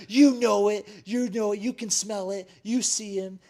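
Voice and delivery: high, loud mock yelling; heated accusation. Sister calls out her dad and brother, yelling. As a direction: imitate a sister firing back accusations.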